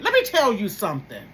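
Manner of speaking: normal talking voice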